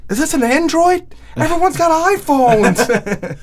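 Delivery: put-on a spoiled teen complains to dad about her phone. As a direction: drag it out like a disgusted spoiled kid.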